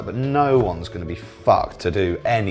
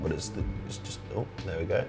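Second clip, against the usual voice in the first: muttering; 4 semitones lower, 15 dB quieter, faster than usual. Real, not acted. Muttering while fixing fallen egg.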